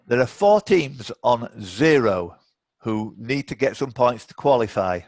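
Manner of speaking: ordinary talk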